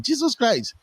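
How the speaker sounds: high, fast, astonished rush; amazed exclamation. Retold or imitated. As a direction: gush out in disbelief. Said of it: "I'm like".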